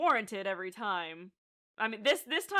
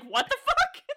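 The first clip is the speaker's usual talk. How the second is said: high, loud disbelieving cry; hammed-up shock at the news; a character reeling from awful news; shocked outburst; 9 dB louder than usual. Claire's shocked reaction acted out.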